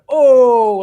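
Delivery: big mock audience "oh"; mock crowd reaction. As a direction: imitate a whole audience reacting to a risky joke. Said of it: "everybody's going".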